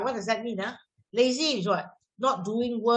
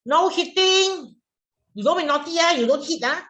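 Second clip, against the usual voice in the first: stern scolding; 6 semitones higher, 5 dB louder than usual. Acted. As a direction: scold a child for hitting. Acts teacher scolding a hitter.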